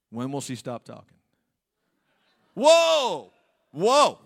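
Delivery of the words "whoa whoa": high, loud astonished whoa. Retold or imitated. Preacher echoing Adam's amazement, loud and high. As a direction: blurt it out.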